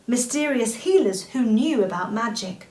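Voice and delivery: ordinary delivery, nothing marked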